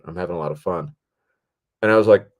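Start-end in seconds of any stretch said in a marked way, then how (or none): 0.0-1.0 low-key, sincere-sounding retold remark; earnest praise. Actress reassures her worried director, earnest praise. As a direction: say it evenly, as an understated compliment. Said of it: "it was such a fake out".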